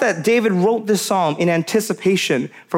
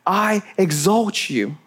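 slow, reverent I exalt you; hushed reverent praise; slower than usual. Shown as a demonstration. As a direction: lift the words like worship, slowly. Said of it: "you can hear the reverence in, the, in his voice".

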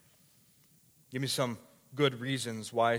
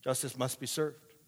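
ordinary talk

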